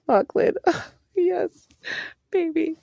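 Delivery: high, loud giggly swoon; giggling swoon. Real, not acted. Reader gushing over the book hero, high giggly squeal. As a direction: squeal over him with laughter.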